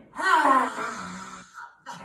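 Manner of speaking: loud breathy roar with tongue out; lion's breath roar. Shown as a demonstration. Teacher demos lion's breath, a loud breathy roar. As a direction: push the breath out like a roaring lion. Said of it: "And lion".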